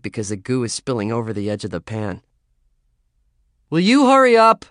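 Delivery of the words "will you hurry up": impatient yell. Acted. Yelling across the house.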